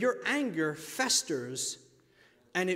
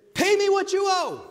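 loud, high, furious demand for payment; harsh demand; 13 semitones higher, 10 dB louder than usual. Acted. Servant shouting at his debtor, angry demand.

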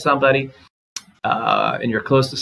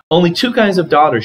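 a raised, higher mother's shout; read as a shout; 7 semitones higher, 6 dB louder than usual. A narrator's reading. Mother shouting at her daughter, a raised read-aloud shout. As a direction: lift the mother's voice into a shout, not a scream. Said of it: "she shouted in Chinese".

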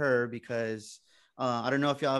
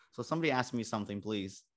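usual speaking voice

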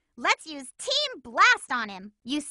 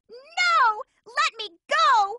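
panicked protest; 10 semitones higher, much slower than usual. Acted. Amy struggles against her captor in a high panicked cry. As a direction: fight against being dragged off. Being captured.

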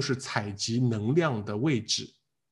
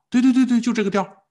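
much higher and louder yes-yes-yes; excited agreement; 10 semitones higher, 5 dB louder than usual. Real, not acted. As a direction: burst out in eager agreement. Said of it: "teacher eagerly agrees".

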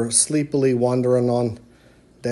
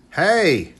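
higher, drawn-out mock roar; monster roar; 4 semitones higher than usual. A narrator's reading. Scariest monster startles Daddy Bear, mild mock roar. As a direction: call it out as a storybook monster's roar. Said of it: "the scariest monster roared".

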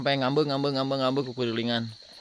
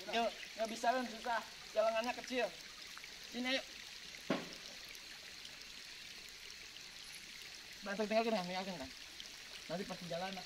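hushed talk; 6 semitones higher, 16 dB quieter than usual. Real, not acted. Very quiet speech near animals.

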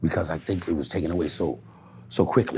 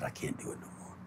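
soft whisper, breaking off; whispered tearful quote; 4 semitones lower, 19 dB quieter than usual. Retold or imitated. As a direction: whisper it like she is in tears.

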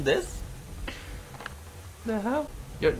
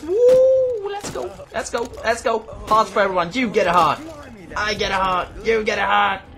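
loud, much higher whooping and cheering; excited cheering; 7 semitones higher, 5 dB louder than usual. Real, not acted. Handing out hearts to every teammate, loud excited cheering.

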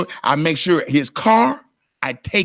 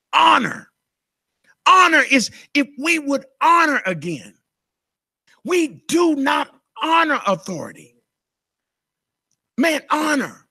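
fiery preaching; 9 semitones higher than usual. Acted. Pastor pressing honor on the congregation, preaching hard. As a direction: preach it with full force.

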